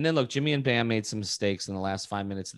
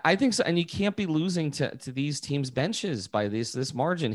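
ordinary talk, nothing marked

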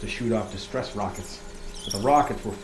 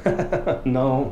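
a short chuckle before the denial; laughing; 5 semitones higher, 4 dB louder than usual. Acted. Keeper teasing listeners about rat traps, laughing.

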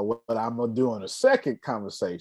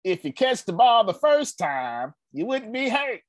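higher, mock-stern coach voice; imitating Coach Wallace; 10 semitones higher than usual. Coach scolding injured receiver after a drop, mock stern. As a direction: lecture the hurt player like an old-school coach. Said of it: "That's how he talked".

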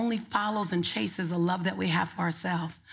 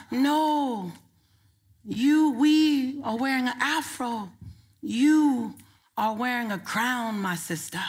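slow, lightly put-on African accent; a gentle, proud correction in a hint of an African accent; 2 semitones higher, 3 dB louder, slower than usual. Woman telling her the afro is a crown, lightly voiced African accent. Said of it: "That's my African accent".

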